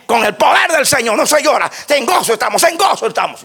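high-pitched parody of pulpit zeal; mock jubilant declaration; mock triumphant preacher. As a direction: proclaim it like a hammed-up, tearless preacher. Mocking preachers who forbid tears.

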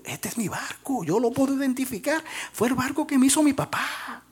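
put-on a little boy spotting his lost toy; child voice. Boy in the shop window scene, high child voice.

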